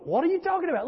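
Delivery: high-pitched, incredulous question; squeaked-up mock bewilderment; retold bafflement. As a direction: act out utter confusion under attack. Acts out his baffled reply.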